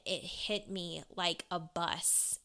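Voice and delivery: ordinary delivery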